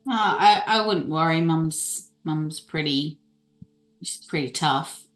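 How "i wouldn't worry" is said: usual speaking voice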